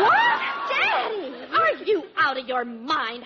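shocked. Real, not acted. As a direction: yelp in disbelief. Shocked at every boy.

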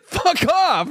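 high, drawn-out mock outburst; exasperated. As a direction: imitate someone totally fed up. Retelling a fed-up film character.

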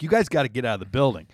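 rushed, firm order; fast demand. Retold or imitated. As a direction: rattle it off to get rid of them. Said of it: "So I'm like".

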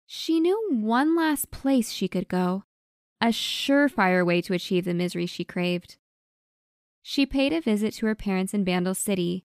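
usual speaking voice

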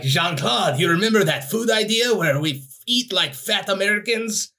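high, hammy French chef voice; exaggerated French accent, very high. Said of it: "It's like".

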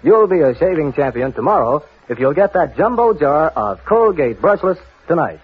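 ordinary talk

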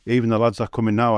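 ordinary talk, nothing marked

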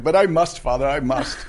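high, loud mock-tragic plea; melodramatic daughter. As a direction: ham up a noble farewell. Mimics daughter's melodramatic reply.

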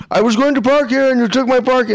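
high, exaggerated Indian accent imitation; hammed-up Indian accent complaint; mock Indian accent. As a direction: ham up an Indian accent for the angry driver. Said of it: "he got the full on fucking Indian".

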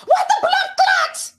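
high-pitched, drawn-out outraged yell; mock outraged shout. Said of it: "They was going in on Jamaican Twitter".

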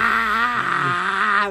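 long, high mock guffaw; laughing in his face. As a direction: imitate a drunken laugh, high and drawn out. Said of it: "but, like, in his face".

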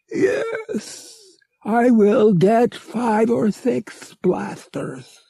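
high, slow alien voice; a giant alien answering high and steady; alien agreeing. A narrator's reading. Murg agrees to fetch blasters, high and slow.

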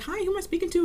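high, sugary put-on caller voice; mock caller voice. Library patron mocked on the phone, high and prim. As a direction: ape the entitled patron sweetly up high. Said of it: "She was like".

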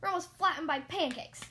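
agitated instructor's reprimand; scolding. A narrator's reading. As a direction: tell them off, alarmed. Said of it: "Rusty scolded me".

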